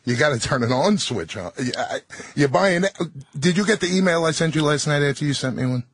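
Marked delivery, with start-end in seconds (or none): none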